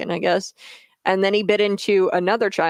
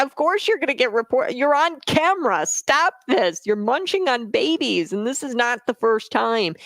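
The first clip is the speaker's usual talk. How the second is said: exasperated rant; 5 semitones higher than usual. Real, not acted. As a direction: tell him off in disbelief. Ranting at Biden directly.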